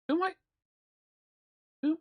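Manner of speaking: normal talking voice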